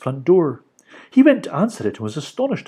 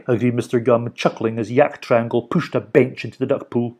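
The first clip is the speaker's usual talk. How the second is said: ordinary delivery, nothing marked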